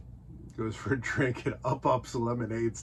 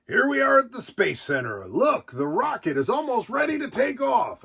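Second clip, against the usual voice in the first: higher, chipper reading voice for the storybook; bright storybook character voice; made-up character voice; 9 semitones higher, 3 dB louder than usual. Acted. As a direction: read it like a cheery cartoon narrator. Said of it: "I don't know whose voice that was, but that's the one I'm gonna do".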